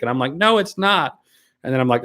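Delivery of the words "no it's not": indignant retort. Retold or imitated. Retorting to "it's demonic" comments.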